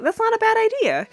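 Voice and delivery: a hammed-up pleased mother; Claire. Claire approving Rudy's offer, high hammed-up mom voice. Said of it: "Claire was like".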